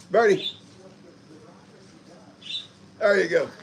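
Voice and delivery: high, loud doggy talk; talking to the dogs. Real, not acted. Man handing his dogs treats, in high, loud pet talk. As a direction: coo over the dogs while handing out treats.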